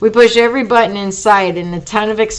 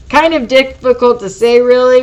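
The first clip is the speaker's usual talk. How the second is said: lightly pondering drawl, pitched up; slow ponderous drawl; 4 semitones higher, 5 dB louder than usual. A narrator's reading. Jet Girl reporting the score from above, ponderous drawl. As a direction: draw out the start as if weighing it. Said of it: "replies Jet Girl in a slow, ponderous drawl".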